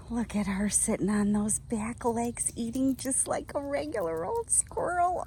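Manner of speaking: hushed whisper to the viewers; quiet whisper while watching her pet. Real, not acted. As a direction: whisper so the animal isn't startled.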